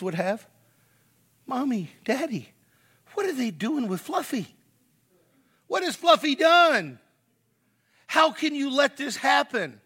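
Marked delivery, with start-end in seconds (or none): 1.5-9.8 put-on high, rising child's voice growing louder and more distressed; child crying out to mommy and daddy about the pet lamb; upset child. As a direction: plead like a frightened little kid. Kids voiced asking about the lamb.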